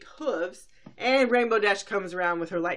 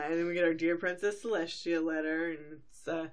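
normal talking voice

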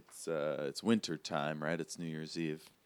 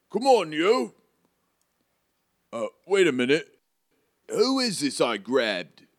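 higher, louder, burly-helper character voice; put-on storybook character voice, pitched up; Hagrid; 9 semitones higher, 6 dB louder than usual. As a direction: call out urgently in a character accent. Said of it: "Hagrid starts to get up".

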